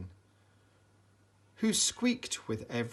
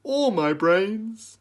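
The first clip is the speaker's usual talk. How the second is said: scarecrow; 9 semitones higher, 9 dB louder, slower than usual. A narrator's reading. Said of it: "said the scarecrow".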